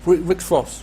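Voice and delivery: Rex Fox said the English way, with a light put-on English accent; mock English accent. Shown as a demonstration. Streamer greeting viewer Rex Fox in a mock English accent. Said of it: "avec l'accent savoyeux anglais".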